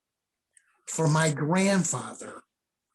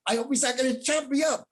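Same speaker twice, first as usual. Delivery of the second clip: higher, fast mock-worried aside; joking fear of the blade; mock nervous thought; 8 semitones higher, faster than usual. Inner thought facing bladed staff.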